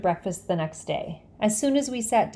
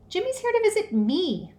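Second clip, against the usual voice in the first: 1 indignant; 11 semitones higher than usual. A narrator's reading. Jealous boy asserting.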